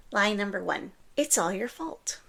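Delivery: ordinary talk, nothing marked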